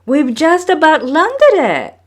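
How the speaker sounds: high and loud with triumph; excited cry. A narrator's reading. Maui telling brothers the catch is nearly landed, excited. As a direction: shout it, almost there. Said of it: "Maui cried".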